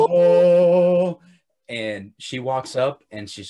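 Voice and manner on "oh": loud held choir note; angelic choir. Retold or imitated. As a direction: sing out like a heavenly choir. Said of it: "deep angelic choir from the background".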